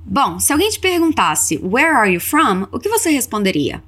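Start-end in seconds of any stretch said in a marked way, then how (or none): none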